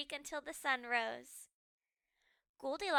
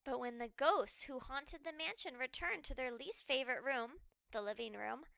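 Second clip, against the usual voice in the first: ordinary delivery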